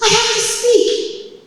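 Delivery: high, loud, drawn-out insistence; urgent shout. Retold or imitated. Wife answering her stunned husband, urgent shout. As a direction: shout it back high and slow.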